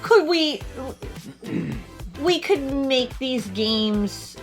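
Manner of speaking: ordinary delivery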